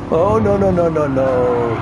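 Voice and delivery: drawn-out no no no in dismay; dismayed groaning. Real, not acted. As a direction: stretch the nos out as it goes wrong. Losing position in race.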